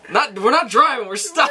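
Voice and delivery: high laughing shout. Retold or imitated. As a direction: blurt it out laughing. Michonne's correction retold.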